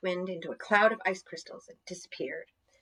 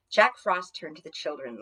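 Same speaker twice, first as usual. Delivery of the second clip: plain reading voice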